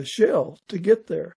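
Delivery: ordinary talk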